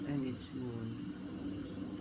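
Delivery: "And its moon" drawn out very slowly, flowing on; no stop after moon. Shown as a demonstration. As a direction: stretch "And its moon" and don't stop there. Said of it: "You don't stop".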